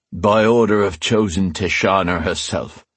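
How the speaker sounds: ordinary delivery, nothing marked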